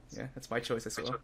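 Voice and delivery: ordinary delivery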